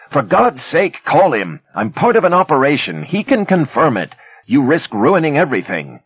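high, loud frantic plea; urgent plea. A narrator's reading. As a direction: beg urgently, near panic. Captive urgently pleads.

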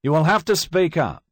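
loud, high demand from the bench; judge calling out. Acted. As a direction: call down sharply to be heard. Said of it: "One of the judges called out".

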